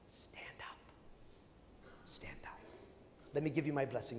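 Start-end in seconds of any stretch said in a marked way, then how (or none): 0.1-2.6 soft, unvoiced whisper of the Lord. The Lord telling the faithful at Communion, whispered. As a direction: breathe it like a secret comfort.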